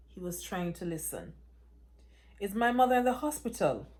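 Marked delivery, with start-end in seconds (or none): 2.4-3.9 louder, higher and fast; panicked demand. Acted. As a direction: fire the question at him in panic. Daughter demands news, panicked.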